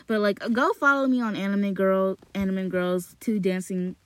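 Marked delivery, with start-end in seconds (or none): none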